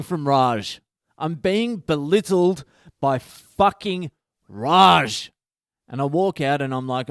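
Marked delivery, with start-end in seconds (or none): 1.2-5.3 slow, raised pitch, louder with mock outrage; exasperated outburst. Delivery driver belittled by a customer, exasperated outburst. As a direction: drag out each word, landing hard on the name. Comic outburst at being snubbed.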